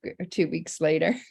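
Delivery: usual speaking voice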